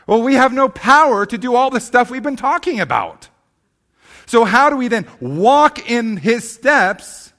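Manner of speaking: loud, high, driving sermon voice; full-volume pulpit preaching; preaching at full force. Acted. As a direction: preach it hard, rising to a near shout.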